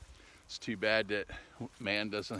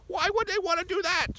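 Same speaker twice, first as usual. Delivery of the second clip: extremely high, loud squeaky voice; squeaky questioner; 6 dB louder than usual. Acted. Sidekick pressing Ed for the reason, piercing squeak. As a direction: squeal the question at the very top, loudly. Same question repeated far higher, louder.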